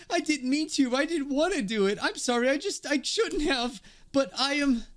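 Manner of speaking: high, flustered character voice; acted frantic confession; panicked apology. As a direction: plead in a high, distressed voice. Character panics confessing what she did.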